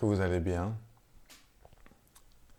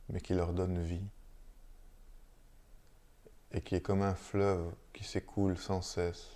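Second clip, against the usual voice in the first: unhurried, hushed meditation guidance with long silences; guided meditation; 7 dB quieter than usual. Real, not acted. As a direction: lead listeners gently into stillness, phrase by phrase. Guide une méditation après la lecture. (méditation guidée, posée, entre de longs silences)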